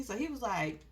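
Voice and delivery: ordinary talk, nothing marked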